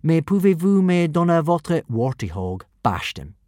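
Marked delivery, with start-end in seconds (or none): 0.0-1.8 French. A narrator's reading. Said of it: "said Hiccup absent-mindedly".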